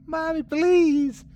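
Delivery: high, drawn-out plea; slow, high desperate wail; child crying out. A narrator's reading. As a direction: beg as a frightened little girl. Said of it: "Myrtle cried".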